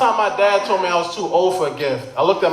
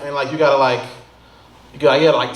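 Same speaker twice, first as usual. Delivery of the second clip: ordinary talk, nothing marked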